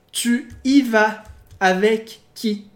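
slow, deliberate "Tu y vas avec qui?" with every word separated; slow model sentence for students; slow careful reading. Shown as a demonstration. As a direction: read "Tu y vas avec qui?" slowly for learners. Teacher reads example slowly.

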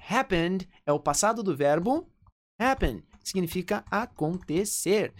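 normal talking voice

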